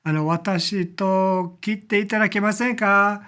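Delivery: politely asking in Japanese; a courteous Japanese request. Shown as a demonstration. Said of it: "You can say".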